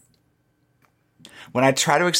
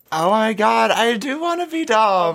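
high, breathless airhead gush; ditzy girl voice; 9 semitones higher than usual. Acted. Playing a ditzy persona.

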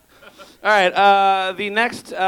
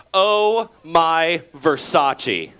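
slow drawn-out Versace; much slower than usual. Retold or imitated. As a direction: stretch "Oh my Versace" very slowly. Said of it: "one character says to another character".

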